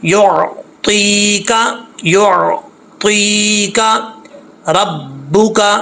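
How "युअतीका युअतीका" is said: "युअतीका" में या को एक अलिफ भर खींचकर; धीमा, लंबा खिंचा "युअतीका"; long vowel drawn out. Shown as a demonstration. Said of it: "एक अलिफ की मकदार इसको खींचेंगे". ("युअतीका" को मद के साथ लंबा पढ़ो)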